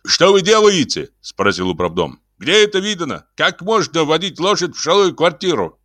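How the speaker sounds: high-pitched outraged building manager; scandalized, high-pitched telling-off; indignant scolding. A narrator's reading. Building manager scolds over horse in flat.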